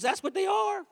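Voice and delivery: put-on high, childlike insistence; little kid voice.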